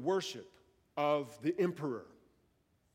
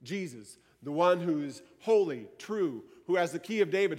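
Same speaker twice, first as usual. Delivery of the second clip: ordinary delivery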